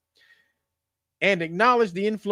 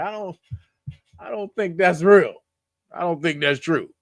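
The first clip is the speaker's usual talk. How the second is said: ordinary talk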